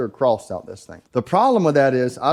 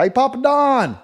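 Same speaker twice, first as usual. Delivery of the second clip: mock prank call; 10 semitones higher, 3 dB louder than usual. Prank call to Papa Don.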